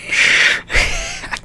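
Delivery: squeaky disbelieving giggle; high squeaky laugh. Real, not acted.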